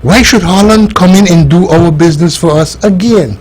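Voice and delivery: usual speaking voice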